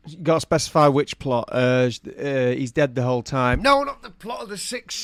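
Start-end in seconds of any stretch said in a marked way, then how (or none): 3.6-5.0 high jokey yell; playful shout. Real, not acted. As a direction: shout it in mock protest. Jokey shout about wrong plot.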